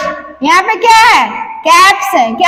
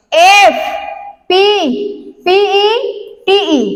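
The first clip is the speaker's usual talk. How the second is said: slow spelling; much slower than usual. Shown as a demonstration. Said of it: "spelling letters".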